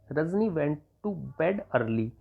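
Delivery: very slow, word by word; slow read of "Rajni went to bed early"; slow dictation. Shown as a demonstration. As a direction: dictate it slowly for students. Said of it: "तो आप लिख देंगे".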